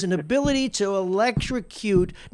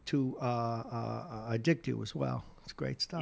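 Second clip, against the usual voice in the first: mock sinister aside; 8 semitones lower, 13 dB quieter than usual. Mock-sinister aside about addiction.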